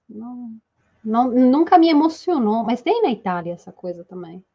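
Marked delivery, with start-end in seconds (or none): none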